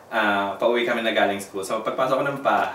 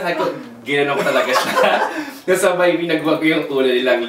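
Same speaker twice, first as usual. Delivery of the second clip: ordinary talk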